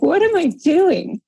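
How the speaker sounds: high, drawn-out mock exasperation; exasperated self-check. Coach recalling arguing to be right, mock exasperated.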